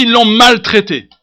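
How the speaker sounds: loud, high pulpit cry; emphatic declaration. Acted. Preacher denouncing the doctors' mistreatment, emphatic. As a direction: proclaim it with force. Loud take, then hushed repeat.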